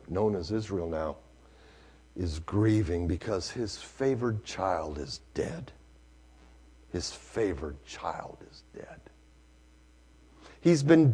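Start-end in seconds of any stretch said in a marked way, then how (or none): 2.2-5.7 hushed grief. Acted. Sermon drops low and soft. 6.9-9.0 whispered, grieving repetition. Acted. Repeated in whisper.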